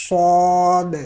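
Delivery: صاد long and full-mouthed; full heavy saad. Shown as a demonstration. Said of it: "پر پڑھنا ہے اور سیٹی کے ساتھ".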